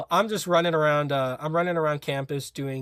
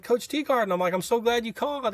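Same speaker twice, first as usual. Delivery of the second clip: higher, eager and thrilled; a young recruit thrilled at the coach's call; eager excitement; 9 semitones higher than usual. Retold or imitated. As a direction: gush like a recruit who got the call.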